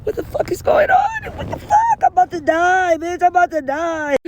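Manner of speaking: shrieking way up high in mock panic; panicked high shriek. To the friends rubbing her back, a high panicked shriek. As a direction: squeal it like you're about to lose it. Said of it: "And I'm like".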